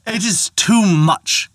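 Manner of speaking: high, slow, exasperated father; angry outburst. Acted. Father outraged at his careless daughter, angry. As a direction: let the anger spill out high and slow. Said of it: "was angry".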